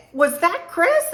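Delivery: high, slow retold double take; surprised. Asking her friend about the passing car, high-pitched surprise. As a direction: re-enact startled disbelief, pitch jumping up.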